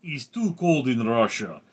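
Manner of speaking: put-on Russian accent, lively pitch swings. Shown as a demonstration. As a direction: tell a joke with a Russian accent. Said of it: "Or perhaps Russian accent".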